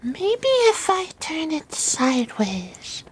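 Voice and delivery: drawn-out, light wondering tone; puzzled spell caster. Acted. Player as Hazel puzzles over her spell, slow and musing.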